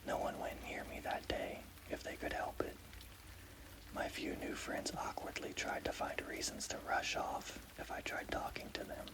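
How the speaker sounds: ordinary delivery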